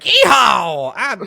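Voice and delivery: high, whooping mock Texas accent; caricature Texan. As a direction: holler it like a cartoon Texas cowboy. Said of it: "no one character that's like".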